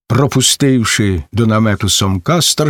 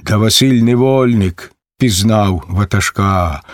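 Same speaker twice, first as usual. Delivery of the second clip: plain reading voice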